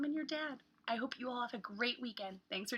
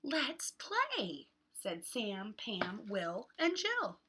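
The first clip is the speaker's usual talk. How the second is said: lively picture-book read with voiced characters; reading to children; slower than usual. Teacher reading a beach book aloud to kids, lively. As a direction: read it like story time for little ones.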